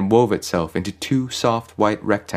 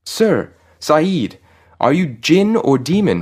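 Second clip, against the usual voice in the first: high, loud alarmed call; pleading shout, pitched high; 8 semitones higher, 5 dB louder, slower than usual. A narrator's reading. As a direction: call up to your captor in alarm. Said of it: "Farid called out".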